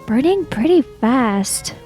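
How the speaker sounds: ordinary talk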